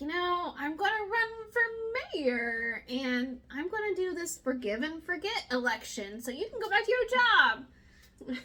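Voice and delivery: high sing-song mock politician; Bernard. Candidate announcing a run for mayor, mocking high voice. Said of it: "And Bernard's like".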